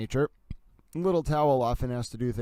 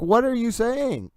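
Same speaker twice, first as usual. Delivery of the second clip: confused students; 11 semitones higher, 5 dB louder, slower than usual. Retold or imitated. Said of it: "they'd be like".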